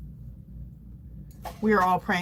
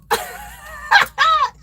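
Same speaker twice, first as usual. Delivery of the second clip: laughing at the imagined viewer's reaction; high laugh; 5 dB louder than usual. Real, not acted. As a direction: giggle up high and loud. Laughing at own joke.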